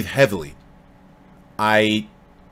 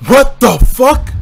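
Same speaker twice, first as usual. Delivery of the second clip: stunned shout; 11 semitones higher, 9 dB louder, slower than usual. Real, not acted. Shocked at game scene.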